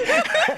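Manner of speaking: loud, high burst of laughter; real high-pitched cackling laugh. Real, not acted. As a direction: crack up at your own story. Cracks up at friend's line.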